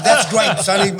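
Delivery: loud laughing "that's great, son"; laughing praise. Retold or imitated. Teacher praising a student, loud and laughing.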